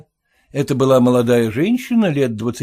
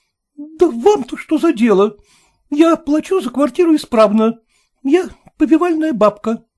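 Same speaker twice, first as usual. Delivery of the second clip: high, offended retort; lady's voice, defiant; indignant lady; 14 semitones higher, 4 dB louder than usual. A narrator's reading. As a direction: defend herself against prying.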